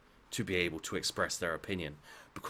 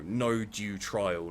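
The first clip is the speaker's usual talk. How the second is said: usual speaking voice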